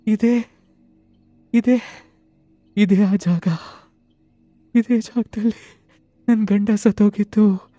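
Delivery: high, loud, slow wailing grief; keen over the place her husband died; ಅಳುತ್ತಾ ಗೋಳಾಡುವ ಧ್ವನಿ; crying ghost. Acted. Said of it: "ಅವಳು ಮತ್ತೆ ಅಳ್ತಾ ಅಳ್ತಾ ಹೇಳ್ತಾಳೆ".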